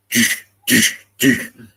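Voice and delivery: punchy, spaced doosh sounds; imitated pulsing boom. Man voicing the gum trees pulsing, imitating booms. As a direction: fire off evenly spaced bursts. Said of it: "And they're like".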